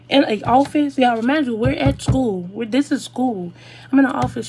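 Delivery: loud outraged recap; exasperated. Real, not acted. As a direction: stress how ridiculous it was. Outraged at being told to lie down.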